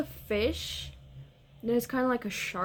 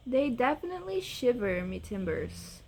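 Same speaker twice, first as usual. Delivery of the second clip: ordinary delivery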